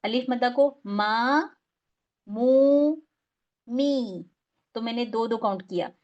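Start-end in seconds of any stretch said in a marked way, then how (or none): 2.3-3.0 मू held long for two counts, higher and louder; two-count vowel. Shown as a demonstration. Teacher repeating madd vowel for students, stretched. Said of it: "इसको pronounce कैसे करना है".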